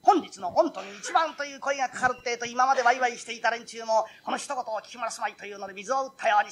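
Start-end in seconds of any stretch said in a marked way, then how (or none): none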